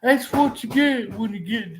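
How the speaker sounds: mocking a smug critic, pitched high; high, scoffing imitation of a know-it-all. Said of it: "For anybody out there says".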